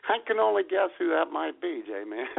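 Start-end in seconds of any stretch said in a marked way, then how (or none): none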